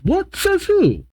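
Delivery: squeaky outraged outburst, no added volume; very high, hammed-up protest; mock shout. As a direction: shoot the pitch way up in indignation. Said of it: "Mabel shouts".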